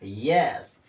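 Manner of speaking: यस with the y sounded, pitched low; correctly pronounced yes. Shown as a demonstration. Teacher drilling pupils on the correct यस, y sounded. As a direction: start यस on a clear "y" glide. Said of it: "क्या पढ़ेंगे मेरे बच्चों?".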